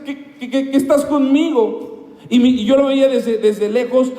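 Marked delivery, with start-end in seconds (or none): 0.0-2.1 high, pleading call to his father in the dream; retold pleading cry. Son calling up to his late father, high and pleading. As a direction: cry out, aching to reach him. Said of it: "yo le gritaba".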